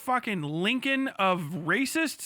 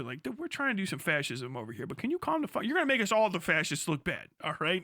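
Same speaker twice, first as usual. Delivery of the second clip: lower, softer, quick mock grumble; fascist complaining; 6 semitones lower, 4 dB quieter, faster than usual. Mock fascist ally grumbling at Spain, low and quick. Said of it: "what the fuck did Spain even do".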